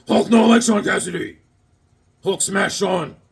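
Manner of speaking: mock-Hulk caveman grunt talk. Comic fan reading the cover as the Hulk, mock brute voice.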